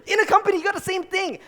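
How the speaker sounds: laughing. Real, not acted. Laughing through next words.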